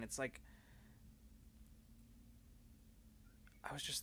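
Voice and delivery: soft, hesitant, halting voice; quiet confession. Acted. Alma confides her old fear to Bina, softly halting. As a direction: speak softly, searching for words.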